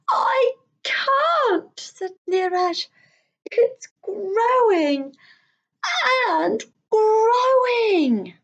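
high slow wailing; frightened child. Acted. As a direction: wail it, helpless and scared. Scared child in story.

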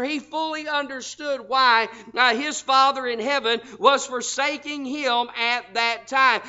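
ordinary talk

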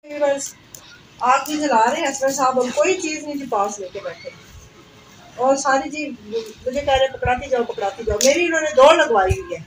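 ordinary talk, nothing marked

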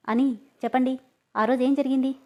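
stern demand for the truth; serious. A narrator's reading. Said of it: "అని సీరియస్గా అడగడంతో".

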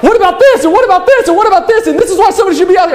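high, fast exasperated listing; retelling his own frantic complaints; worked-up retelling. Retold or imitated. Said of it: "and I'm like".

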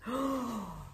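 wolf huffing breath. Acted. Wolf blowing.

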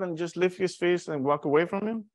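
usual speaking voice

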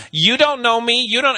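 high, loud, confrontational talk-show guest; mock a defiant guest shouting at the audience; talk-show guest imitation.

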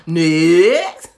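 loud announcer call. Real, not acted. Announcing the next candle to viewers, loud call. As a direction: shout it like calling the next contestant.

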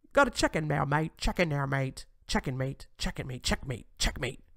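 clumsy mock-British accent, mate; badly faked British accent, playfully repeated. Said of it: "I can't even do the British accent".

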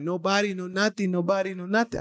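put-on broken-English accent, pitched a little up; imitated foreign accent. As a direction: mimic a driver pleading in accented English. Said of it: "forgive me of this accent".

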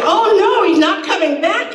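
hammed-up horrified wail; retold scream. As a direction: shriek "oh, no" in sudden dismay. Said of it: "she screamed".

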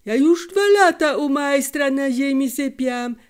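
shouted boast. A narrator's reading. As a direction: shout back to outdo them. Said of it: "odkrzyknął blady Józiek". (Józiek przechwala się, krzycząc przez podwórko)

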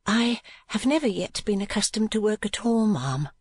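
ordinary delivery, nothing marked

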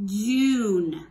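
JUNE loud, with a long oo; JUNE, drawn out and carefully sounded; model word. Shown as a demonstration. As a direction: stretch out JUNE for pupils to repeat. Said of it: "Everybody say June".

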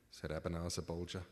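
plain reading voice

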